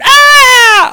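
very high, loud panicked shriek; a piercing, frightened scream. Retold or imitated. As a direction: shriek at the spider all over again. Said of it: "she screamed".

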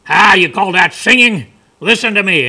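brash fable crow showing off; boastful crow. Acted. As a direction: butt in loudly, scornful and cocky.

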